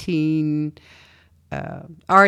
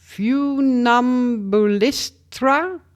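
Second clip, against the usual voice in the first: slow, drawn-out attempt at an unknown name; sounding out; 5 semitones higher, much slower than usual. Real, not acted. Host tries an artist's name they can't pronounce, sounding out. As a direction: stretch the syllables, feeling your way through. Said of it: "I don't know how to pronounce the next one".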